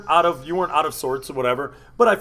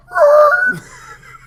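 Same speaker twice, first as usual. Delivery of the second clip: piercing high screaming laugh; wild mock villain cackle; maniacal shrieking cackle; 12 dB louder than usual. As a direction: let out a crazed shrill shriek of laughter. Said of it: "this bitch goes".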